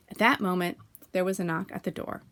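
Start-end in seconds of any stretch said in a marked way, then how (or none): none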